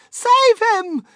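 very high, loud panicked mother; frantic high-pitched shriek; screaming. A narrator's reading. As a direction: scream desperately for rescue. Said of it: "screamed Missus Gloop".

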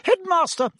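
high-pitched hysterical indignation. A narrator's reading. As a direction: protest at the invasion of your ward. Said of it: "said Madame Pomfrey hysterically".

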